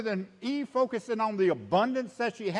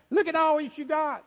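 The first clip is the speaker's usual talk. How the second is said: voicing God; 5 semitones higher than usual. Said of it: "That's the emphasis in God's command".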